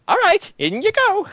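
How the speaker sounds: very high, fussing send-off; fussy professor. Acted. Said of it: "he says".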